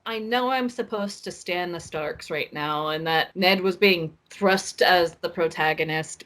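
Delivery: ordinary talk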